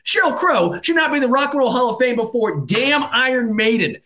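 high, heated ranting; worked-up fan raging about the snub; angry rant. Real, not acted. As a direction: vent real fury at the Hall of Fame. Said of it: "I'm angry".

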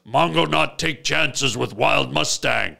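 blunt caveman caution; a simple brute being careful; caveman talk. Retold or imitated. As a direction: caveman Mongo refusing to take chances. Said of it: "he still talks like this".

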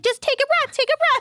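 very high, squeaky little-kid imitation; little daughter soothing. Daughter to her crying baby cousin, imitated in a tiny high voice. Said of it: "her cousin's younger and she's six months old and she's crying, she's like".

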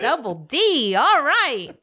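mock the leering guy, loud and high; hammed-up dude voice. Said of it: "he was probably like".

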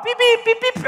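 high mimicked phone-timer beeping; timer beeps. Speaker imitating his phone timer going off, high beeps. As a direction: chirp out the alarm beeps.

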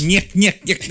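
high, loud nyek-nyek-nyek; Three Stooges nyek. Pretend. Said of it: "This sounds like a Three Stooges episode".

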